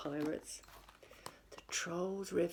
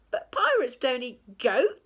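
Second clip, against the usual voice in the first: high, loud pirate voice; a captain explaining pirate rules; indignant pirate; 5 semitones higher, 8 dB louder than usual. A narrator's reading. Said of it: "said Hank Chief".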